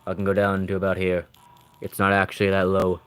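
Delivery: very low, soft and slurred; trying out how low the voice can go; lowest voice. Shown as a demonstration. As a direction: drop to the bottom of your range and slur along. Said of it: "how low can I do a voice?".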